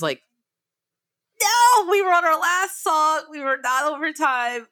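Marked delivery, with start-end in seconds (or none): 1.4-4.7 high-pitched mock protest; mildly acted exasperation; exasperated protest. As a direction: protest in disbelief, voice raised in pitch. Protesting the interruption mid-set.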